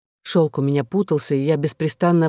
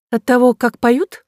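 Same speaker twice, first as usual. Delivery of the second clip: raised, challenging interjection ending on a rise; interrupting question; 7 semitones higher than usual. A narrator's reading. Singer interrupting the abbot about her cousin, cutting in. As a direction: cut in with a higher-pitched question. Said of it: "прервав его".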